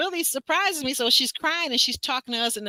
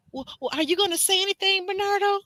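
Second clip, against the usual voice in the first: mock pleading; 9 semitones higher than usual. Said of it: "She was like".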